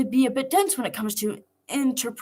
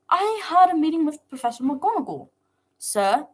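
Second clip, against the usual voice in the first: said stiffly; 6 semitones higher than usual. A narrator's reading. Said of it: "I said stiffly".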